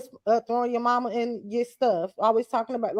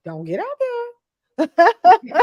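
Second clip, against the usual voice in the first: drawn-out warning; 7 semitones higher, 7 dB louder, much slower than usual. Retold or imitated. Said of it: "told her before, like".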